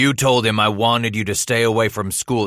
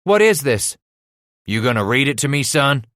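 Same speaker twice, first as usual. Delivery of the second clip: mildly angry father, rising on the question; impatient demand; 5 semitones higher, 5 dB louder than usual. A narrator's reading. Father to son who won't read, impatient. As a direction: press the boy for an answer.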